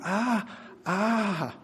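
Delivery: high, soft pained "ah"; acted cry of pain. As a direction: re-enact a drawn-out yelp of pain, held back. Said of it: "it hurt so much there were tears".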